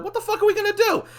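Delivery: high-pitched panicked outburst. Acted. Friend facing war within the hour, panicked.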